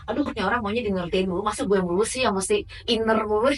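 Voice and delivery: loud exasperated griping; fed-up complaint. Retold or imitated. Participant voicing her frustration at always having to adjust, loud and exasperated. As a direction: vent it loudly as if sick of always giving in.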